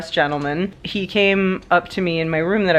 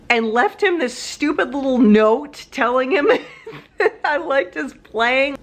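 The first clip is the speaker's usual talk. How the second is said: higher, laughing through the words; laughing embarrassed; 7 semitones higher than usual. Real, not acted. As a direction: laugh at your own blunder.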